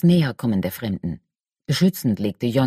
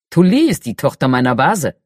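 fire giant voice; 5 dB louder than usual. Acted. Talut the red-bearded giant speaks.

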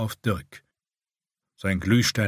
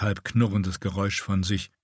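plain reading voice

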